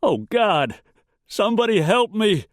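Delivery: high, desperate cry for help; frightened, wailing appeal; panicked gasp. A narrator's reading. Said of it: "Wolford gasped".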